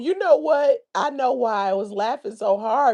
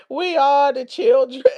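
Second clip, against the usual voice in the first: laughing through the words; giggling as the title comes out; cracking up mid-line. Real, not acted. Laughing through mock song title.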